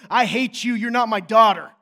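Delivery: loud, hammed-up rejection; mock furious yell. What he'd never say to his daughter, mock-angry shout. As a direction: play an enraged parent at full volume.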